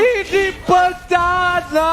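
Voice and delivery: very slow, drawn-out shout; fighting game announcer. Acted. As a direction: stretch out the doom call. Caster's final shout.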